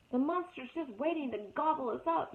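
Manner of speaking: high, fearful shriek; groundhog shriek. Acted. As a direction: panic at the monster. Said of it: "shrieked Digtown".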